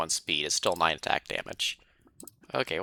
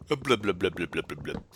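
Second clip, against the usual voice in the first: shouting underwater; 3 dB quieter than usual. Pretend. Swimming underwater, mock bubbling noises.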